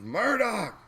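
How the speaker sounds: retold alarmed shout; sudden yell. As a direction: bark the name out as an alarm. Orton yells as attacker charges.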